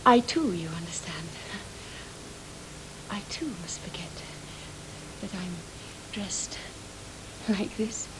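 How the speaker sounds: hushed whisper, unvoiced; a shamed secret whispered to him. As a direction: breathe the words out secretly. Confiding she must forget her dress.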